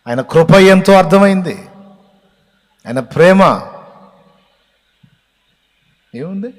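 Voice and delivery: loud, high impassioned preaching; fervent preaching. Real, not acted. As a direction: preach it out with full force. Preaching at full force.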